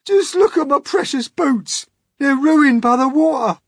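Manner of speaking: high, loud, hammed-up complaint; dismayed cry. A narrator's reading. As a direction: wail about a treasured thing destroyed. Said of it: "cried the centipede".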